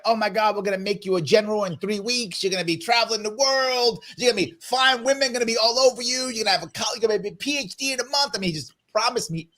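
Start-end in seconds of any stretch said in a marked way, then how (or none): 0.0-8.4 overselling recruiter. Retold or imitated. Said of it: "he was like".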